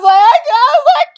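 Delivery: high, loud sobbing wail; crying. Retold or imitated. Told to get out of the car, crying wail. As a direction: wail it out mid-sob. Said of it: "I start crying".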